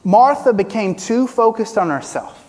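usual speaking voice

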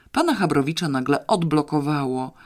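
usual speaking voice

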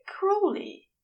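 a fussy angel about to object; high protesting angel. A narrator's reading. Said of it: "he won't allow him to protest".

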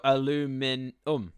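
"aluminum" drawn out, without the extra I; syllable-by-syllable pronunciation. Shown as a demonstration. Trying out the American word, "aluminum" sounded out slowly. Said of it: "That's why we say aluminum".

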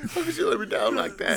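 very high, hammed-up wail; mock crying. Podcaster mimicking friend who was let fall, mock tearful wail. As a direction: wail like a betrayed friend, played for laughs. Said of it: "He starts crying".

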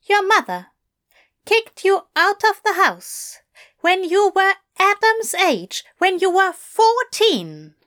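high, disbelieving Aziraphale; aghast protective Aziraphale, voiced high; appalled Aziraphale. A narrator's reading. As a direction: let the outrage rise in disbelief.

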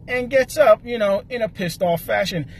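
ordinary delivery

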